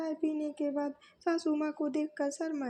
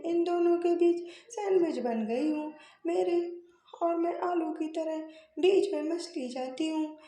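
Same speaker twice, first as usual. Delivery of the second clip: complaining daughter-in-law; 3 semitones higher, 4 dB louder than usual. Acted. Eldest bahu protests to her mother-in-law, loud and aggrieved.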